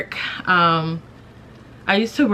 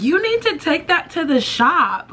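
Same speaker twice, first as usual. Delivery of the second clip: much higher teasing voice; mock teasing coworkers; 10 semitones higher than usual. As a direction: imitate amused coworkers giving advice. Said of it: "they were dying laughing".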